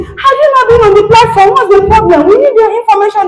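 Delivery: high, pressing phone scold; high, insistent demand on the phone; urgent scolding call. Retold or imitated. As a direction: press them hard for the missing information. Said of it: "And I called police".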